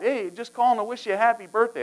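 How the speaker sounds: high, singsong well-wisher; cheery birthday wishes. Retold or imitated. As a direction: imitate an upbeat caller with birthday greetings. Caller wishing happy birthday.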